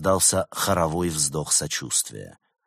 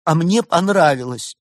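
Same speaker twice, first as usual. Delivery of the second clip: tearful; 11 semitones higher, 10 dB louder than usual. A narrator's reading. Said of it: "со слезами в голосе произнесла Берта".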